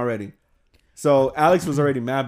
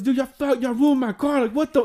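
high, louder outraged yell; angry outburst; 12 semitones higher, 4 dB louder than usual. Retold or imitated. Alex yelling at friends over egg in his car, outraged.

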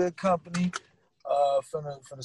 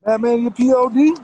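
loud spell-out; 4 semitones higher, 8 dB louder than usual. Real, not acted. Spelling handle out loudly.

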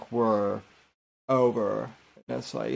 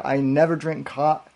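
ordinary talk, nothing marked

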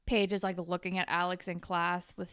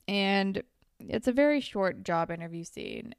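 ordinary talk

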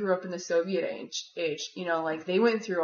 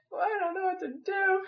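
high, thin mock-crying voice; helpless wail; 11 semitones higher, 3 dB quieter than usual. Woman retelling her midnight breakdown, helpless high whine. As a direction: whimper it high, half laughing at yourself.

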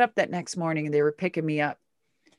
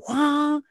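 high, short burst of "wah"; imitated engine surge; 7 semitones higher than usual. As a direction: sound out hitting the gas.

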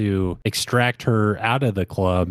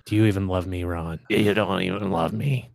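put-on soft slurred drunk mumble; drunk mumbling; 3 semitones lower, 4 dB quieter than usual. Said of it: "mumbling that shit".

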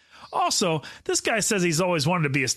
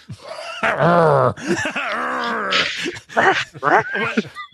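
loud shrieking laugh; howling laugh; 6 semitones higher, 5 dB louder than usual. Real, not acted. Reacts to homo joke.

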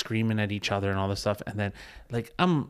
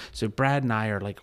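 normal talking voice

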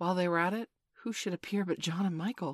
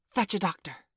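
raised, urgent command; cried order; 3 semitones higher, 5 dB louder than usual. A narrator's reading. Peter sends Slightly for a doctor, crying out. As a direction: call out the order. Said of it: "he cried".

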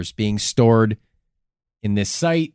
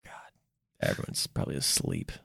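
hushed whisper; 11 semitones lower, 13 dB quieter than usual. Real, not acted. Host whispering that the listeners have fallen asleep. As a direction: whisper it so nobody wakes. Late-night aside about sleeping listeners.

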